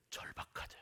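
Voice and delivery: whispered, very soft emphasis; hushed intensity. Real, not acted. Preacher describing desperate faith to congregation, urgent whisper.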